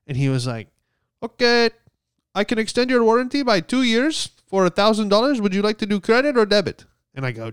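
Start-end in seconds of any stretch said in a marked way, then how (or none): 1.2-6.8 high mock caller voice, speeding up at the end; imitating scam caller. Scam caller rushes the warranty offer in a high mock voice. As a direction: rattle off the sales pitch in the put-on high voice. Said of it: "that's exactly how he sounded".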